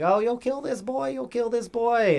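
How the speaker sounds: very high-pitched spirit taunt; Turbo Granny taunting. Acted. Turbo threatening to kill the boy, high-pitched taunting. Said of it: "Turbo's just like".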